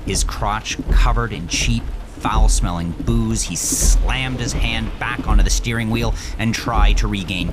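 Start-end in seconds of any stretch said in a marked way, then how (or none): none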